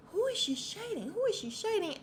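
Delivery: high-pitched, incredulous repeated question; nosy mock question. Viewer reacting to a celebrity's shady look, high mock nosiness. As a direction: squeal it like you need the gossip.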